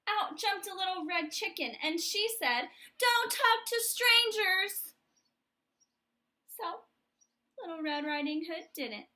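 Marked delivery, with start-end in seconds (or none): 3.0-4.9 high, loud, slow shouted warning. Acted. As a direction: burst in and shout it. Chicken bursts in warning.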